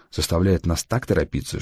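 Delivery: plain reading voice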